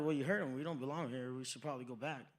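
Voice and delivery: low, fading resignation; lightly voiced resigned mom; deadpan mom. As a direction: shrug it off like mom. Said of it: "My mom was like".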